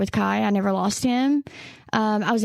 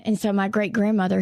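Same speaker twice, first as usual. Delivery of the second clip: ordinary talk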